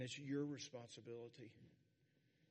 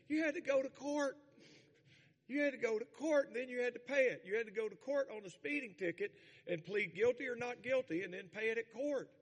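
loud, high, insistent preaching; raised voice, pressing each point; emphatic exclaiming; 7 semitones higher, 7 dB louder than usual. Real, not acted. Preacher insists on old-time hassle.

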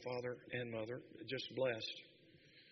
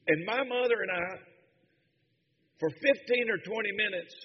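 loud, high preaching cry, mother's words ringing out; preaching at full force; 8 semitones higher, 13 dB louder than usual. Acted. Pastor to congregation, retelling his dying mother's call, in a full preaching roar. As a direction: carry the church up with her joy.

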